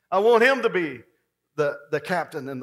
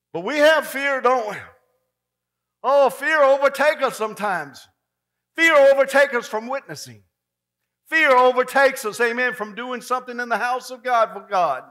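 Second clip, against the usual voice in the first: higher, louder preacher's cry; preaching hard; 8 semitones higher, 11 dB louder than usual. Acted. Preacher driving it home to the congregation, full force. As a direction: shout it to the church.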